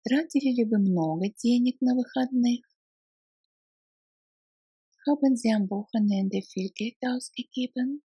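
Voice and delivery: ordinary talk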